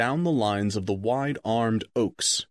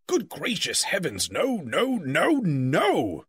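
higher, rising protest of no, no; exasperated wizard; 5 semitones higher, slower than usual. Acted. Wizard horrified at Bilbo's blunder, higher, exasperated. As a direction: wave the notion away in alarm. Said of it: "said Gandalf".

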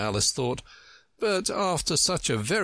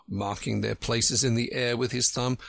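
usual speaking voice

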